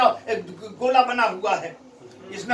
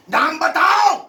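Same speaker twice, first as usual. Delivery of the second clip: louder, higher ruler's demand; commanding challenge; 5 semitones higher, 4 dB louder than usual. Acted. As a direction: demand the name like a drunken tyrant. Ibn Ziyad challenges his drunken court. (حاکم کی بلند للکار)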